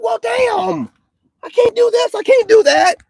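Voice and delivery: high, loud exasperated mock whine; frustrated seeker. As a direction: throw up your hands, squeaky and fed up. Acts out frustrated spiritual seeker.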